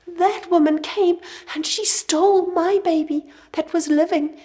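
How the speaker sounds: sobbing plea. A narrator's reading. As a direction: plead for the child through sobs. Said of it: "in between her sobs".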